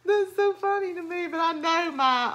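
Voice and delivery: high-pitched, squeezed laughing talk. Real, not acted. Amused by her own roof imitation, laughing.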